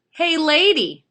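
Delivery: high, loud parrot call; loud, high bird heckle; heckling parrot. Acted. Parrot heckles the returning lady.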